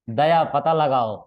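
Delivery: loud, slow commanding detective; CID officer order. Retold or imitated. Quoting the CID show to the class, loud slow command. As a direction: bark the order slowly like the CID boss. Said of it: "CID में भी था।".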